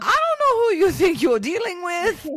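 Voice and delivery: high, loud scolding; mother scolding her daughter, loud and high; mock yell. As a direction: bark it like an exasperated mom.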